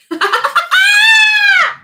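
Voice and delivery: sudden high shrieking laugh; loud laughing shriek. Real, not acted. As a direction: let a shrieking laugh burst out. Bursts out laughing mid reading.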